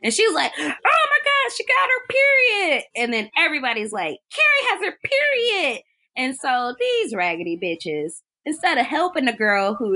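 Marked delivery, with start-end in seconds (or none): 0.6-2.8 very high, gleefully scandalized cry; hammed-up announcement, pitched way up; mock bully's shriek. Imitating Chris noticing the blood, high mock shriek. 4.3-5.8 high, taunting crowd voice; mock jeering. Imitating everybody telling on Carrie, high mocking call.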